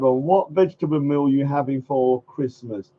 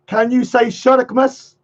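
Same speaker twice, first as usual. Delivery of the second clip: usual speaking voice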